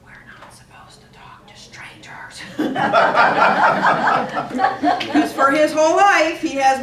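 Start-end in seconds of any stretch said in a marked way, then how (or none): 0.0-2.4 breathy whispered secret; a boy whispering worried to mom. Retold or imitated. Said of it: "He leans over to me". 2.5-5.1 cracking up remembering; loud breathy laugh. Real, not acted. Mom laughing at son.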